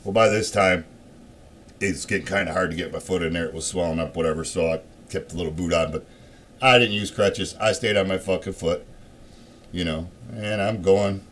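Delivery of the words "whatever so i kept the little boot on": ordinary talk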